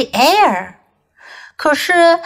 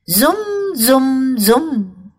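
zooming sound; much slower than usual. A narrator's reading. Tiger rushing tidying.

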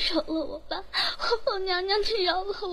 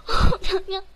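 crying for help; 4 semitones higher than usual. Acted. Tortured maid cries to the empress for help, sobbing. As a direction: beg through tears in pain.

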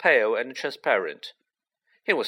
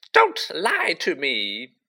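slow, higher, hurt outburst; tearful gasp; 5 semitones higher, 4 dB louder, slower than usual. A narrator's reading. Ghost girl in tears accuses the girl, gasping. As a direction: accuse through mild tearfulness. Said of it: "Myrtle gasped".

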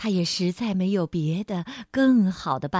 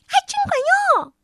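high squealing creature voice; squealing sprite; 8 dB louder, much faster than usual. A narrator's reading. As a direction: shriek it out in delight. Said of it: "小精灵尖叫着". (尖叫的小精灵)

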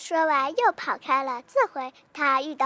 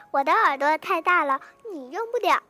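usual speaking voice

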